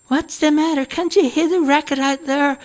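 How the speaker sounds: put-on high indignant old woman echoing; a fussy mother throws the question back, high; old mother's voice. Mother echoes her indignantly.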